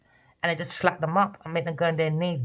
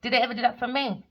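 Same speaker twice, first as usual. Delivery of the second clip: ordinary talk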